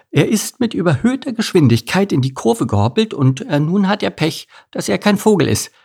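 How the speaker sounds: high, lilting bird voice; high-pitched storybook thrush; thrush character, explaining. A narrator's reading. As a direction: explain it in a small bird's high voice.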